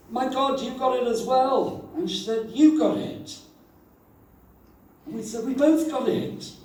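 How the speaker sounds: high, excited exclamation; high delighted surprise; thrilled disbelief. Retold or imitated. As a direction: burst out with joy. Thrilled recall reunion.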